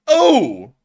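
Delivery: sudden loud high Oh; surprised shout. Real, not acted. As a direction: burst out at the explosion. Tank explodes on screen.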